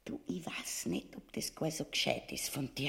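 hushed warning whisper. Acted. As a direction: lean in and whisper the doubt. Wife warns in hushed tone.

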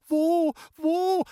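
put-on very high, loud girl's shriek; shrieked cry. A narrator's reading. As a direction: shriek it out in alarm. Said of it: "hat Ännchen geschrien". (schriller Schrei eines aufgeregten Mädchens)

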